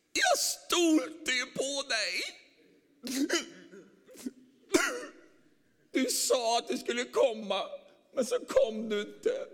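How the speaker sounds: high, loud, slow wounded cry; a betrayed believer crying out high and loud; hurt complaint. Acted. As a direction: wail it out like a let-down believer. Disappointed believer, acted.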